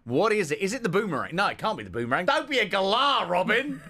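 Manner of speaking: high, hammed-up Aussie accent; squeaky put-on Aussie voice; mock Australian. Host teasing co-host in a high mock Aussie accent.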